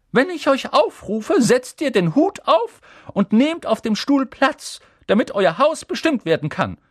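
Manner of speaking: high, loud teacher addressing the class; a strict professor giving instructions; stern teacher. A narrator's reading. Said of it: "sagte sie". (strenge Professorin, hoch und laut)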